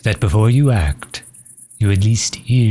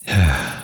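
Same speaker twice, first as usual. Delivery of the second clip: breath before a hard admission; reluctant sigh. Acted. Vampire struggling to ask for help, reluctant sigh.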